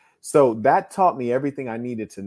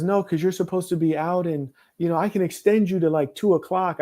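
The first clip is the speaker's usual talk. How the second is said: higher-pitched mimicry of a rude hotel caller; imitated rude caller; 6 semitones higher than usual. Hotel caller telling guest he should be out, imitated rude voice. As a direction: put on her voice, pitched up and officious. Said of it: "why are you being so rude about it".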